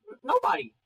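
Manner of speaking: high, loud stammered denial; acted cornered kid, pitch leaping up; flustered denial. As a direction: blurt it out defensively, stumbling on the start. Child cornered by scolding Dad.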